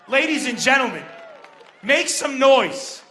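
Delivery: loud, slow drawn-out introduction shout; hype announcer. Acted. Host hyping the crowd for the next comedian, loud and stretched out. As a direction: bring the next act up like a boxing announcer.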